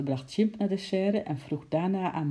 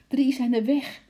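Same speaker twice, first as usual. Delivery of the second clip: loud, high and out of breath; panting; 10 semitones higher, 7 dB louder than usual. A narrator's reading. Keeper out of breath from running, panting the news. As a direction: gasp it out after running. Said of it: "hijgde Bart".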